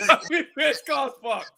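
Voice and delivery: slowed, laughing through the words; can't hold the laugh in. Real, not acted. Friends roasting the fight, slowed laughing delivery. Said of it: "Flip's sake".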